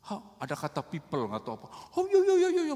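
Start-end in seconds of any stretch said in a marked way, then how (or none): 1.9-2.7 high mock jabber; mimicked nagging. Woman grumbling from afar in the store, high mimicked nagging. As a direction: squeak out nonsense grumbling. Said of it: "mau ngomel lagi".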